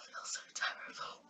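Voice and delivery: tearful whisper, barely voiced; fighting back real tears; choked up. Real, not acted. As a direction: voice giving way to real emotion. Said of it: "I can feel my eyes welling up".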